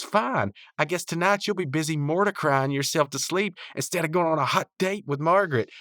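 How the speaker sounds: high-pitched imitated huff; mocking taunt. Rigby taunting Mordecai about Margaret, high mock huffiness. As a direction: needle him like a petulant cartoon character.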